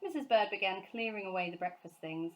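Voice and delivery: ordinary delivery, nothing marked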